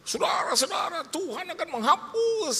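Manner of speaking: high, loud mimicked preacher, building to shouted amens; fiery preacher. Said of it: "saya dengar khotbah". (pengkhotbah berapi-api, berseru nyaring)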